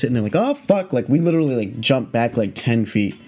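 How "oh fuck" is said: mild retold alarm, pitched up. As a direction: imitate a startled recoil. Jump back from angry snake.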